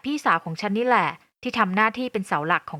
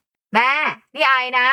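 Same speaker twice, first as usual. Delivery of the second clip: raised voice on the phone, near a shout; calling loudly; 4 semitones higher, 4 dB louder, slower than usual. Acted. Daughter phoning her hard-of-hearing mother, loud near-shout. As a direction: call out so a deaf ear can hear. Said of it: "ต้องพูดเสียงดังจนเหมือนตะโกน".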